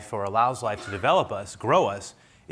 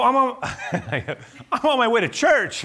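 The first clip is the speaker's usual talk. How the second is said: much higher, louder, laughing through it; laughing protest; 13 semitones higher, 7 dB louder than usual. Retold or imitated. Pastor telling the officer he's going to church, laughing loudly. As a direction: laugh while insisting on your innocence. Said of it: "I go".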